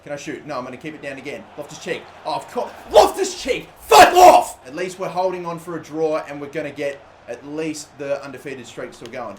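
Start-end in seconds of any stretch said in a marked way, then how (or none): 2.9-4.6 very high, loud angry shout; furious yell. Real, not acted. Gamer raging at an opposing attacker, furious shout. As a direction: scream at the player in rage.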